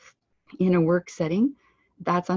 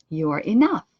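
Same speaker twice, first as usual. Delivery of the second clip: YOU ARE quiet, ENOUGH louder; stress on ENOUGH; 6 dB louder than usual. Shown as a demonstration. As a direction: glide over YOU ARE and land loud on ENOUGH. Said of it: "you are" is quiet, "enough" is louder".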